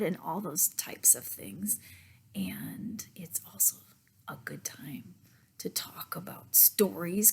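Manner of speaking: hushed whisper; softly whispered. Real, not acted.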